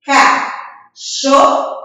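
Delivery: "Cachorro" very slow, a pause after each syllable; three isolated beats for "Cachorro". Shown as a demonstration. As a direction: count "Cachorro" on fingers, ca... chor... ro. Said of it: "Vamos contar nos dedos?".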